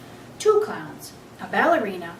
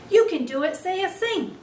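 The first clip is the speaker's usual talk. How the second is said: high, loud urgent squeak; a little hamster shouting encouragement; squeaked out loudly; 10 semitones higher than usual. Acted. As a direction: cheer her on at the top of a tiny voice. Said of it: "I squeaked out as loudly as I could".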